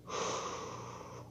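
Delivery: long soft exhale to calm down; deep breath. Retold or imitated. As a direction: act out breathing away irritation. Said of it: "He takes in a deep breath".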